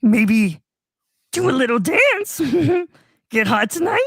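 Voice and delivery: creepy clown calling down the sewer; Pennywise impression. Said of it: "Pennywise luring Kermit".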